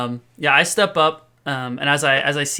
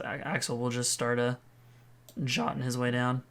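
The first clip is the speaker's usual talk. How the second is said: ordinary talk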